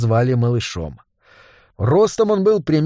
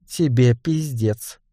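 firm threat; 2 semitones lower, much slower than usual. Acted. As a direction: say it flat and final. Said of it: "твёрдо ответила она". (твёрдо и медленно)

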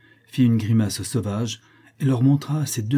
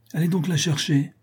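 higher, pushy command; mock-impatient order to the natives; feigned impatience; 7 semitones higher than usual. A narrator's reading. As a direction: pretend to lose patience. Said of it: "simulant l'impatience".

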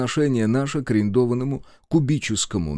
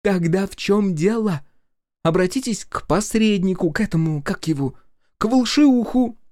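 indignant Masha; 10 semitones higher than usual. A narrator's reading. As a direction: snap back indignantly. Masha's high voice.

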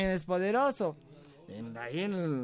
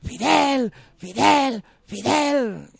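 high, loud chant; multitud que grita; 7 semitones higher, 6 dB louder, much slower than usual. Acted. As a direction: play a crowd shouting back its answer. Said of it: "Y gritan". (coro de multitud, gritado y agudo)

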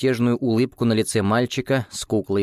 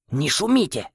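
whisper yelling; 6 semitones higher than usual. Acted. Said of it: "яростно прошипел Дис".

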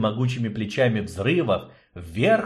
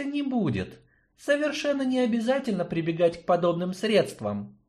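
tender reassurance; 11 semitones higher than usual. Acted. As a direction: soothe him kindly. Yarina reassures the dwarf.

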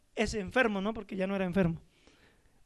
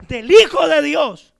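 loud, slow, hammering each word; preaching shout; 2 semitones higher, 12 dB louder, slower than usual. Real, not acted. Preacher thunders the answer to the congregation, loud and slow. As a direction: proclaim it to the congregation with full force.

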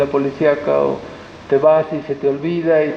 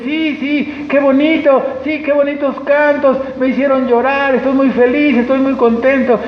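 higher, gushing mock delight; gushing churchgoer; 7 semitones higher than usual. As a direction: overdo the happy convert. Mimics a moved churchgoer.

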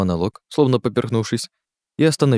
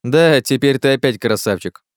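teasing jibe; 5 semitones higher, 4 dB louder than usual. Acted. Teasing Miller about vanished spots.

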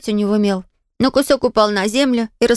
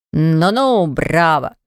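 put-on sarcastic boy; 6 semitones lower, much slower than usual. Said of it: "воскликнул Жифруа".

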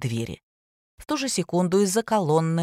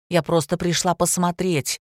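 usual speaking voice

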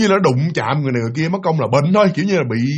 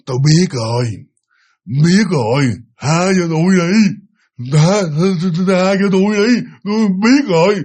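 lightly voiced pleading old woman, a bit louder, rising to a cry; pleading possessed grandmother; 3 dB louder than usual. Possessed great-grandmother begging the spirit master to spare her, pleading and near tears. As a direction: beg for mercy in a thick, possessed old voice that ends near tears. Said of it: "mà là cái giọng năn nỉ".

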